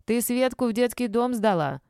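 high-pitched accusation; accusing. A narrator's reading. Old woman accuses mother in row.

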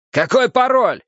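high-pitched, urgent shout; barked demand. A narrator's reading. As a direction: bark it right into his ear. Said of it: "гаркнул я Уве в ухо".

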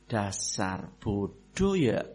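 slow, soft and low, sweetly cutting; soft-spoken insult. Retold or imitated. Husband softly insulting his wife over her mistake, slow and quiet. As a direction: say the insult gently but let it sting. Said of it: "langsung ngomongnya lembut".

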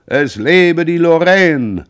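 high, loud, slow drawn-out cheer; crowd cheer. A narrator's reading. Harbour crowd cheering the departing Lorraine, loud slow cheer. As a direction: hail the ship like a cheering crowd. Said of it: "Es schrie".

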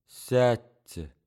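understated sadness; a brief, mildly sorrowful request; sad. Acted. Wronged-looking hostess offers the angry man a chair, sadly. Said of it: "грустно проговорила она".

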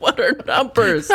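high, slow, laughing mock bafflement; giggling high mock peasant. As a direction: squeak it through a laugh.

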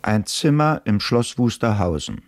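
usual speaking voice

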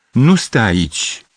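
interrupting. Acted. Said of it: "îl întrerupse tânărul".

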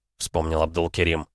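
plain reading voice

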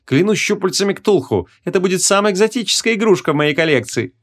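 high-pitched, delighted villain voice; gloating sorcerer. A narrator's reading. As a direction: gloat over a prize in a high voice. Sorcerer gloats over his catch. (высокий злорадно-восторженный голос)

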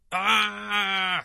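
loud, high, sustained shriek; high startled squeal. A narrator's reading. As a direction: squeal out in sudden fright. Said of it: "diye ciyakladım". (tiz, yüksek bir korku çığlığı)

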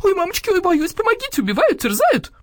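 high, loud cries for help; panicked wailing. A narrator's reading. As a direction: shriek as if being torn apart. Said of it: "завопила Нина Павловна".

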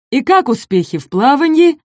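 mock-friendly predator's question; taunting predator. A narrator's reading. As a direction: needle them as the hungry predator. Predator mocks the trapped friends.

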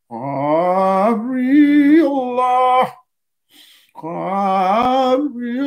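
Gabriel drawn out long on the breath; breath-paced pronunciation. Shown as a demonstration. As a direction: stretch Gabriel slowly, following the breath. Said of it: "si vous le prononcez exactement comme ça".

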